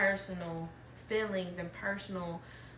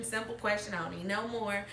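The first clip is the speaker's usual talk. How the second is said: ordinary delivery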